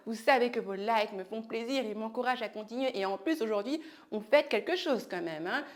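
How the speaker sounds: ordinary delivery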